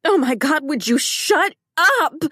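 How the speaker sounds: high, loud desperate scream. A narrator's reading. Wife screaming at husband on the phone, loud scream. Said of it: "This time I scream into the phone".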